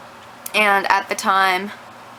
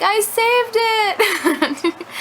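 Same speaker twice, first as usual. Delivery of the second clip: excited; 11 semitones higher than usual. Retold or imitated. Telling boyfriend she rescued cat.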